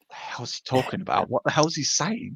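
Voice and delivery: hushed, puzzled mock aside; baffled aside. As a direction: imitate a lost listener grumbling under the breath. Acts out baffled bystander's private remark.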